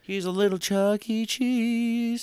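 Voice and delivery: high squeaky cartoon mouse voice; mock squeaky voice. Teasing a rat informant, high squeaky mock voice. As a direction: squeak it like a little cartoon rodent. Mocking rat boy as Chuck E. Cheese.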